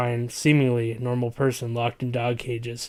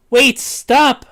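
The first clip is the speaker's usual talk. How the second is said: high, loud pleading scream; 12 semitones higher, 10 dB louder than usual. Acted. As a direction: scream to make them stop. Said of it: "I scream".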